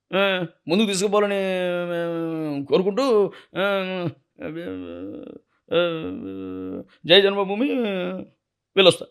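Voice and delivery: Balakrishna's stage speech with pauses between phrases; imitating Balayya's stage speech. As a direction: mimic a star addressing party workers from the stage. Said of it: "అని చెప్పి మాట్లాడాడు stage మీద".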